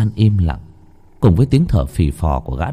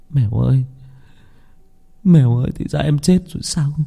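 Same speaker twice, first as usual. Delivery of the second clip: tearful lament; 7 semitones higher than usual. Acted. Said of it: "miệng tôi lẩm bẩm".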